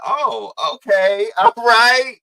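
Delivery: high, slow, loud "oh, okay" into a laugh; surprised and delighted, laughing; pleasantly surprised. Retold or imitated. Reacting to friends' comfortable talk, laughing and surprised.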